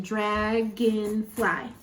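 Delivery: "Dragonfly" slowly in three separate beats; syllable by syllable. Shown as a demonstration. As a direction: sound out each syllable of "Dragonfly". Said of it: "Three syllables".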